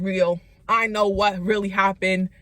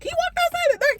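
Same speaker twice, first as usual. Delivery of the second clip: much higher, stunned echo; mock disbelief; much faster than usual. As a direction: parrot it back in shock. Parents repeat in disbelief.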